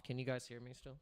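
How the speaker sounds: usual speaking voice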